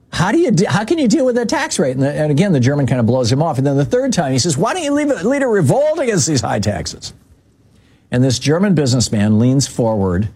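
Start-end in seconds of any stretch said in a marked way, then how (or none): 0.1-1.9 higher, pressing, incredulous reporter; imitating the baffled young reporter, pitched up. Mimicking the young American reporter asking the German, pitched up and incredulous. 4.6-7.1 much higher, pleading reporter voice; a mock exasperated young reporter pushing hard. Imitating the reporter pressing the German a third time, pitched way up.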